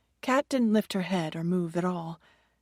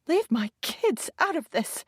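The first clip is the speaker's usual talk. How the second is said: much higher, frantic cry; shrieking; 9 semitones higher than usual. A narrator's reading. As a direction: shriek it in panic. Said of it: "Kat shrieked".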